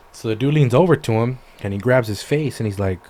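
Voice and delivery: usual speaking voice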